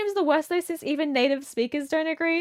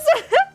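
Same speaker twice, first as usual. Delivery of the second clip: short high giggle; 13 semitones higher, 6 dB louder than usual. Real, not acted. Streamer giggling at the police joke, high giggle.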